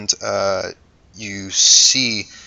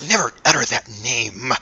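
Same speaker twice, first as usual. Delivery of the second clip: forbidding creature voice; 2 semitones higher than usual. Acted.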